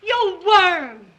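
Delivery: loud, furious yelling; furious shout. Acted. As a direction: throw him out at full volume. Wife throws husband out.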